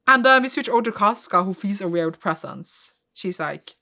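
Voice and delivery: ordinary delivery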